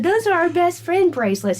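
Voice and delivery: hammed-up indignation at a kid; mock indignant. Teasing son, mock indignant insistence.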